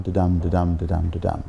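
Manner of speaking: didum didum said low and even, stress on dum each time; iambic beat. Shown as a demonstration. Lecturer tapping out the iambic rhythm for the audience. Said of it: "comfortable with didum".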